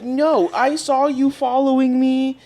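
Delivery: lightly voiced stranger insisting; insistent lady. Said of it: "she was like".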